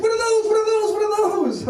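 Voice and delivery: mock-excited lady pointing at coconuts. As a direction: squeal each question like a thrilled tourist. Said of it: "She's like".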